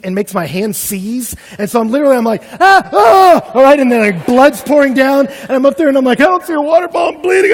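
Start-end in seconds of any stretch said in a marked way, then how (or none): 2.6-3.5 high loud ah ah of pain; cry of pain. Retold or imitated. Thorn stuck in his hand, high loud pained cries. 6.2-7.5 high, strained panicked voice; panicky. Retold or imitated. Lost hiker alone in canyon, high panicky complaint.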